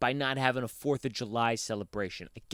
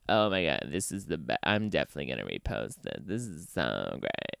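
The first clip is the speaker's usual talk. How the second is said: lower, mock-gushing meme fan; 1 mocking meme sharer; 7 semitones lower than usual. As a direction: lower the voice and gush sarcastically over a meme. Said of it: "and they all are like".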